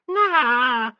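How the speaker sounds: put-on high whiny child's nah; whining child. A narrator's reading. Sissy refusing the question, whining. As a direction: whine it like a sulky girl.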